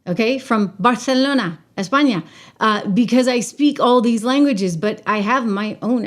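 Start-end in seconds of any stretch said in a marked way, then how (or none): 0.1-2.2 Barcelona said the Castilian way, with a lisped c as "Barthelona"; Castilian Spanish accent. Shown as a demonstration. Vlogger shows viewers her Castilian Spanish accent. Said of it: "with a Spanish accent, okay, from Barcelona".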